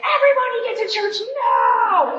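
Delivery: hammed-up hurry-up yell to the family; imitating a frazzled mom calling everyone; mock yell. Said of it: "It was one of those".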